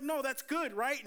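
high, pleased with himself; proud brother. Retold or imitated. Brother telling the pastor he chased the gunman, proud. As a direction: expect praise for it.

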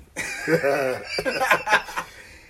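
loud, high chuckling burst; real amused laugh. Real, not acted. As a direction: laugh at your own punchline. Laughing at judge's lesson.

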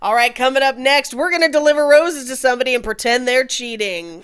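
loud, hyped DJ segment tease; radio DJ voice. Pretend. Still playing the morning DJ, a loud radio voice. DJ bit continues.

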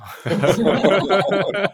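big burst of laughter; loud laugh. Real, not acted.

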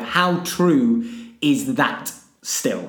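drawn-out words; slow each word right down; slow and deliberate. Shown as a demonstration. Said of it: "I would like you to rank".

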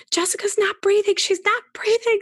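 high, loud panicked cry of alarm; panicked grandparents. Retold or imitated. Grandparents panicking over a child holding her breath, high frantic shout. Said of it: "grandparents would freak out and say".

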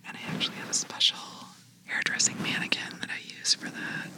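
ASMR whisper. Shown as a demonstration. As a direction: whisper gently, like soothing ASMR. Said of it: "whisper into your ear".

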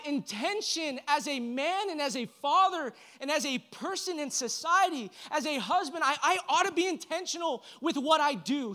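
rising, driving sermon delivery; impassioned preaching. Acted. Pastor urging the men in the room, impassioned preaching. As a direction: preach it out with fire, pushing the men.

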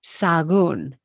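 "Sagún" in two syllables, sa-GOON; silent H. Shown as a demonstration. Teacher showing her way for a tricky town, "Sagún" as sa-GOON. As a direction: say "Sagún" with no H, stressed on the end. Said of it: "we'll see is silent".

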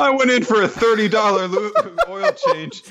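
high, breaking into laughter; laughing. Real, not acted. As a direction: laugh through the story. Laughing while telling the story.

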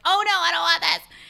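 high, loud cry of alarm; mock panic. Avoidant person fleeing once the partner gives in, mock panic. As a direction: balk loudly in mock alarm. Acted avoidant backing out of relationship.

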